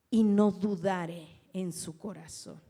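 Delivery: loud, slow preaching of the verse; preaching scripture. Acted. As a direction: proclaim each word to the congregation. Preacher reading scripture aloud.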